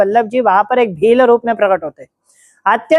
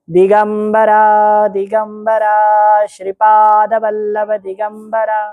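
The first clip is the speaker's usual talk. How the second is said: very slow, distant, echoing chant; voice from the samadhi; much slower than usual. Acted. Shripad's voice from the samadhi blessing the devotees, distant slow chant. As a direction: intone it like a voice rising from a tomb. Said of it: "समाधि स्थल से आवाज आया".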